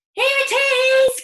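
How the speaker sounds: high, loud, thrilled shout; father rushing into the kitchen, high excited cry. Acted. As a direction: burst in crying out the news. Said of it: "cried George's father".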